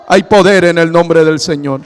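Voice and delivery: firm, loud declaration of "poder"; forceful proclamation. Acted. Pastor proclaiming to the congregation, firm and loud. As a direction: declare it strongly from the pulpit. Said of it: "repeated proclamation, first take".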